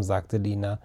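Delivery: ordinary delivery, nothing marked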